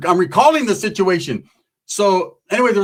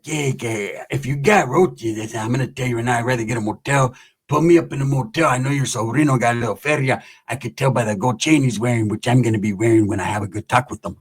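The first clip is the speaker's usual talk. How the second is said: low, smooth-talking homie; bootleg Pepe; 6 semitones lower than usual. Retold or imitated. Homie asking tia to put him up in a motel, low imitation. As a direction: imitate a low-voiced knockoff charmer. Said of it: "trying to sound like a bootleg version of Pepe".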